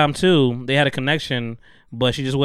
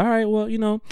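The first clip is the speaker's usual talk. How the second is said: higher, eager simp voice; pushover guy voice; 7 semitones higher, much faster than usual. Retold or imitated. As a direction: play the spineless yes-man.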